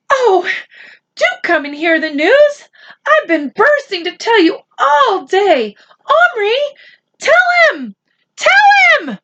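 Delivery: very high, rising to a loud shout; can't hold it in any longer; a mother shouting to her husband and son; bursting with excitement. Acted. Mother bursting to tell father.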